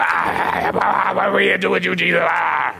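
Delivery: fast wailing demon cry. Pretend. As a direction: cry out like a tormented spirit. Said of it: "That's my demon voice".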